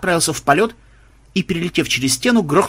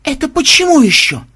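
high, loud indignant question; 12 semitones higher, 5 dB louder than usual. A narrator's reading. Vampire told not to bite anyone, indignant. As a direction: demand a reason in outrage.

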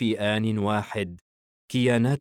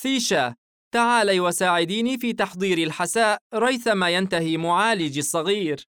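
higher mother's voice, fond; motherly request, pitched up; smiling mother; 10 semitones higher, 5 dB louder than usual. A narrator's reading. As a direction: call a daughter over with playful affection. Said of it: "ابتسمت للعب صغيرها وقالت".

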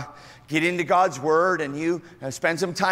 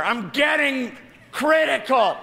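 much higher, slow, shouted answer; proudly yells the punchline; comic shout; 10 semitones higher, 4 dB louder than usual. Acted.

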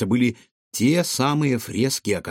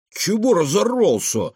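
higher-pitched, cross outburst; angry; 9 semitones higher, 4 dB louder than usual. A narrator's reading. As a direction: snap crossly at a friend who yelled. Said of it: "рассердился Кефир". (сердито)

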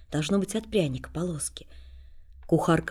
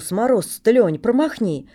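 hearty offer; 4 semitones higher, 7 dB louder than usual. Acted. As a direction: urge him to drink up. Coachman offers a bottle.

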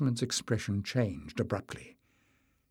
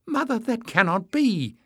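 much higher, urgent protest; burst out; 12 semitones higher, 4 dB louder than usual. A narrator's reading. Emlys protests to her mother, much higher. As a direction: blurt it out in shock. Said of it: "Emlys burst out".

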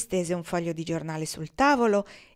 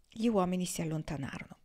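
plain reading voice

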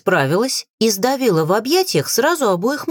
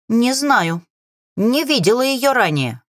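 lightly voiced crow, pitched higher; a hint of a bird's caw; 5 semitones higher than usual. A narrator's reading. Crow answering who the witch is, cawing. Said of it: "каркнула Роза".

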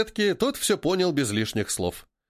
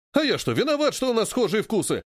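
higher-pitched indignant protest; 7 semitones higher than usual. A narrator's reading. Михалыч defends his taste to Малой, indignant. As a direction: protest your innocence, pitched up. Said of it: "пришла очередь возмущаться Михалычу".

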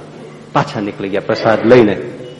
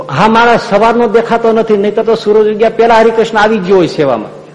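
eager disciple; 9 semitones higher than usual. Acted. Disciple answers Maharaj, puzzled.

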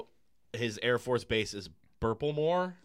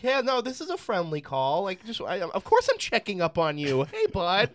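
much higher, meek mock president; timid president soothing the Russian premier, much higher; 8 semitones higher, 5 dB louder than usual. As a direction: placate him timidly on the phone. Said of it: "meek, timid president".

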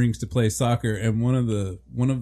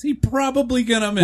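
mock announcer voice; 11 semitones higher, 4 dB louder than usual. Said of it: "repeats mock announcer line".

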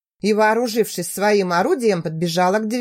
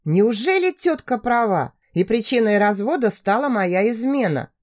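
ordinary delivery

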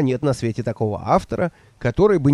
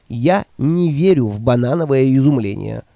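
ordinary delivery

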